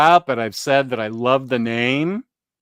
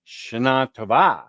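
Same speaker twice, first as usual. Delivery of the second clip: "Shana Tova" as sha-NA to-VA, ending on a falling VA; stress on second half. Shown as a demonstration. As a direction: give "Shana Tova" its Hebrew end stresses. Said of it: "In Hebrew, it's the second half".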